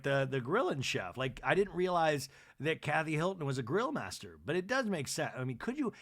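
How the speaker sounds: normal talking voice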